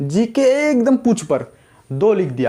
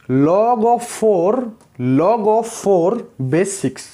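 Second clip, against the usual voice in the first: extremely slow, stretched; slow demo reading; much slower than usual. Shown as a demonstration. Teacher rereading the log for students, extremely slowly. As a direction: pull each word of "log of four base six" long. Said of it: "इसको हम base बोलेंगे".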